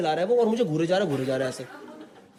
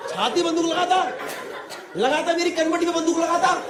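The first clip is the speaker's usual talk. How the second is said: high-pitched indignant rant; pitched-up, hammed-up incredulity; exasperated disbelief; 6 semitones higher than usual. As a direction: demand an answer in comic outrage. Exasperated rant about the threat.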